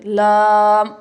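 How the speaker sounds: लाम said loud and clear, full m at the end; correct lam. Shown as a demonstration. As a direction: say लाम slowly, with the m fully sounded. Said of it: "इस तरह से". (लाम को साफ़ और ज़ोर से, आख़िरी म पूरा)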